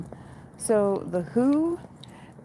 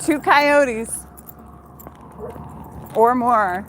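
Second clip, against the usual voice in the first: alarmed exclaim; 3 semitones higher, 4 dB louder, much slower than usual. Real, not acted. Walker counting coyotes ahead of her, alarmed and louder. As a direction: call out the danger.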